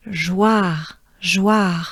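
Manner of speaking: "joie" ending in a French R, just a breath; tongue down, breathe out the R after "joie". Shown as a demonstration. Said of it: "just a breath. Keep your tongue down".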